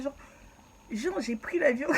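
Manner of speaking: ordinary talk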